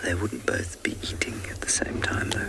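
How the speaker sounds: very soft, hushed commentary; whispering. Real, not acted. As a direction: whisper so the nearby vehicle isn't disturbed. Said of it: "I'm whispering very softly".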